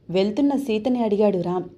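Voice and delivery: ordinary delivery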